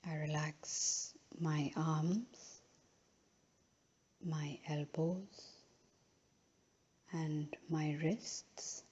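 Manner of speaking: unhurried, measured phrases, each left to settle; guided relaxation. Real, not acted. Yoga teacher guiding a final relaxation in Shavasana, slow and calm.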